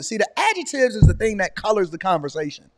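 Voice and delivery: ordinary talk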